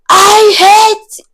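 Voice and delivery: very high, loud mock scream; imitated shouting. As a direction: screech it like the furious woman. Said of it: "She started shouting on top of her voice".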